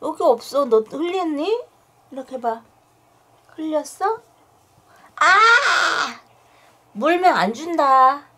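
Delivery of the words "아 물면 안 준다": sudden high yelp then scold; startled yelp. Real, not acted. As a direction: yelp when bitten. Bitten, yelps and scolds. (물려서 깜짝 놀란 외침)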